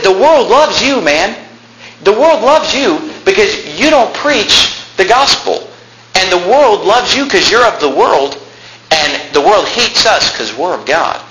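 high, drawn-out preaching rebuke; impassioned rebuke. Acted. Preacher as Paul telling off worldly believers, impassioned rebuke. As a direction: stretch it out high and insistent.

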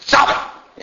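voiceless, forceful snap; sharp hushed scolding. Retold or imitated. Older lady shutting up a child's peep, hissed scolding. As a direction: hiss it to silence a child.

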